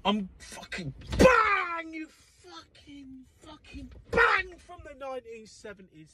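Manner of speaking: high, strained mock yell of a seventies parent; half-cried mock fury; mock rage. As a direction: howl it like a frazzled old-school dad. Mock angry parent outburst.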